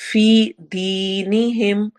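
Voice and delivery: very slow, drawn-out vowels; Quran recitation. Shown as a demonstration. Teacher reciting for students, slow stretched vowels. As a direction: stretch the long ees as you recite. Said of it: "फी में और दी में दोनों में या मद्दा".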